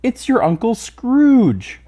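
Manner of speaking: very high, loud and drawn-out slowly; triumphant reveal. A narrator's reading. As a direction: savour the punchline of the game. Triumphant answer to the guessing game.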